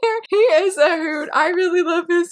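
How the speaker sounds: high, laughing "found him"; delighted find. Real, not acted. Vlogger finding Ranger Red on the page, gleeful and high. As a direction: pop up with glee. Finds the character picture.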